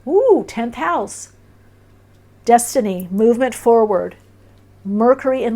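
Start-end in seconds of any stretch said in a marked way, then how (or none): 0.1-1.3 high, slow delighted "Ooh, tenth house". Real, not acted. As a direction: savour the discovery, drawn out and high. Delighted at the tarot card.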